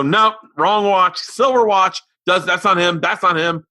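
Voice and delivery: high mock commentary from a kid; insistent daughter. Imitating his daughter judging every suspect's watch, excited and insistent. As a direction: rule each one out emphatically.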